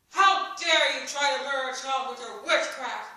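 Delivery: loud, accusing yell at a supposed witch; angry yell. A narrator's reading. Village woman yells at Ellie over the child, loud and furious. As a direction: hurl it at her furiously. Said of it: "one woman yelled at Ellie".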